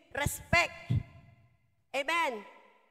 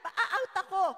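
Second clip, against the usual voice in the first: put-on a higher, half-laughing kid brushing mom off; lightly voiced child; 8 semitones higher, 3 dB louder than usual. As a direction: play the gamer kid stalling. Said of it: "Sabi nung anak".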